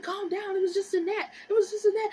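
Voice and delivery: high, loud mimicked mom; insistent mother over a panicking kid; exasperated mom. Mom voiced calming her down.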